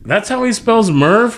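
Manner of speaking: high, slow, disbelieving "That's how he spells Murph?"; retelling his own baffled reaction, stretched and high; incredulous question. Retold or imitated. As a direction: play up the disbelief, pitch way up. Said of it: "I'm like".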